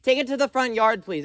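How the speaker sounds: loudest, highest, fed-up yell; exasperated shout. Real, not acted. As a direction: bellow it, out of patience. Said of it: "I said that three times".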